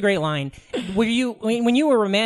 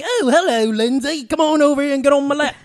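higher-pitched come-on in a British accent; 5 semitones higher than usual. Shown as a demonstration. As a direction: put on a flirty British hello. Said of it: "Give us some of your British accent".

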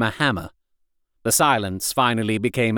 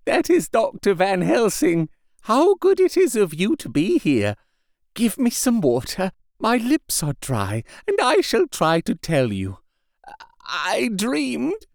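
weak dying patient; 8 semitones higher than usual. A narrator's reading. Injured patient waking to his doctor, frail and high. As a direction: speak like a feeble madman just waking. Renfield greets Van Helsing.

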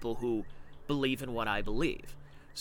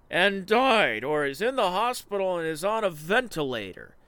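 mock anecdote teller; 3 semitones higher, 6 dB louder, slower than usual. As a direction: spin the chain of hearsay slowly then shriek the ending. Mocking a secondhand covid anecdote.

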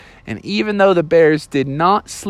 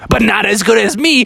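raised, higher-pitched boast; parting taunt; 5 semitones higher, 3 dB louder than usual. Acted. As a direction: taunt them as you get away. Wolf taunts while fleeing.

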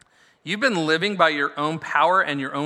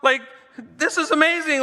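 much higher, laughing, hammed-up awe; mock wonder; 7 semitones higher than usual. Joking about churchgoers gawking at the Spirit. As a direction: gush like a stunned kid. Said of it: "sit in the room and go".

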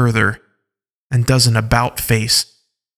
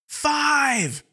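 distant shout; 5 dB quieter than usual. Acted. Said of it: "Rot shouts from a long distance away".

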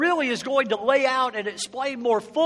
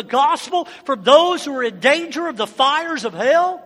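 preach; 3 semitones higher, 5 dB louder than usual. Acted. Preaching at full force.

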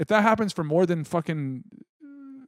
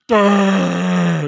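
dumb grunt; 5 semitones higher, 9 dB louder than usual. Retold or imitated. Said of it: "You look like a big fucking dumb idiot".

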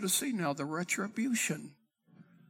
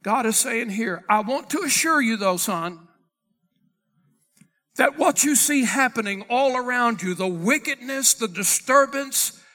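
loud, driving sermon delivery; forceful preaching; 2 semitones higher, 6 dB louder than usual. Acted. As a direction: proclaim God's answer with force.

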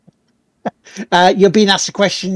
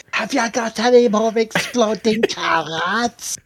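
mock quote; 4 semitones higher, slower than usual. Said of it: "the old classic".

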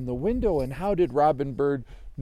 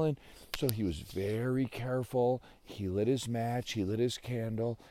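plain reading voice

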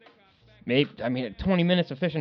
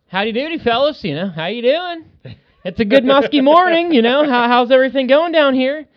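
much higher, louder hammed-up howdy voice; cheesy cheery greeter; 11 semitones higher, 6 dB louder than usual. Greeting strangers at the boat launch, hammed-up cheery howdy. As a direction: play the overfriendly good-ol'-boy hello.

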